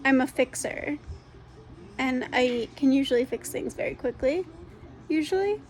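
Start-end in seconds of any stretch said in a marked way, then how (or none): 5.1-5.6 high, doubtful tag-on word; wry afterthought. Real, not acted. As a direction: undercut yourself with a wry lilt.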